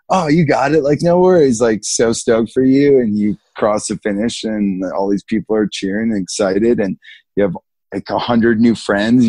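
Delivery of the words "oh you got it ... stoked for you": mock-cheerful encouragement, higher and louder; encouraging hype. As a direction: pump up the nervous newcomer. Others cheering on nervous rookie.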